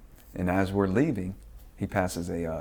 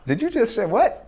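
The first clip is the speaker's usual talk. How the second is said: high, loud, incredulous squawk; can't believe what he just heard; stunned disbelief; 14 semitones higher, 5 dB louder than usual. Retold or imitated. Telling the dad he's out of his mind, stunned and high-pitched.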